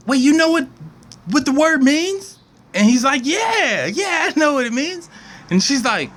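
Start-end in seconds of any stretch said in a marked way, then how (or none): 0.1-2.4 put-on higher eager little girl; a touch of childish excitement; eager kid. Susie eager. 3.2-5.1 eager trash man. Retold or imitated.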